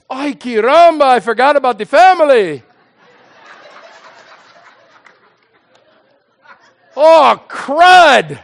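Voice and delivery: loud, comic exclamation of sudden realization; mock dismay. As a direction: play an exaggerated oops moment. God comically realizes he forgot.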